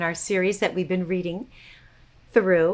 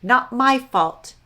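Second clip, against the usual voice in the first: each word set apart, stress on My; slow title stress; 3 semitones higher, 7 dB louder, slower than usual. Shown as a demonstration. Host announcing the story title to kids, slow and stressed. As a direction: spell out the title slowly, leaning on My. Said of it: "This is called Bluster Blue Jay".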